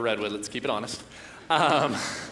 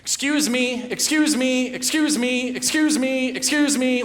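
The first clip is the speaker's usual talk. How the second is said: put-on high, insistent little-girl calls, repeated; toddler yelling; 8 semitones higher, 3 dB louder than usual. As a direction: nag for attention like a two-year-old. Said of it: "my two-year-old daughter begins yelling".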